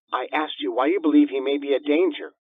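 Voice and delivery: a hint of an impatient dispatcher; lightly voiced operator. A narrator's reading. Emergency operator repeating her question, lightly voiced impatient.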